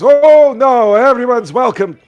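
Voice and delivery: put-on high, loud, folksy old-timer voice; squeaky cheerful old boggart greeting travellers. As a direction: play the friendly old fisherman by the fire. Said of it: "He goes".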